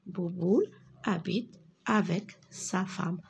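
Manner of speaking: ordinary delivery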